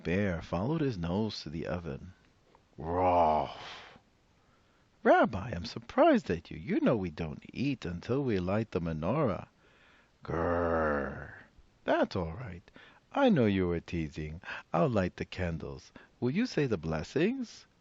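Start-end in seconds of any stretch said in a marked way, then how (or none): 2.8-4.0 bear bark. Acted. Bear barking at the oven. 5.0-9.5 put-on old woman voice. 10.2-11.3 bear growl. Acted. 11.8-17.7 put-on old woman voice.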